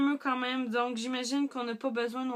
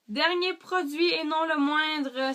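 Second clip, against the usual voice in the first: excited announcement; 6 semitones higher, 7 dB louder, slower than usual. Real, not acted. Announcing the last product.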